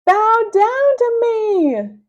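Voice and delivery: high, loud, slow villain command; a tyrant villain's booming order. Acted. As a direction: lord it over him. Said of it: "he commanded".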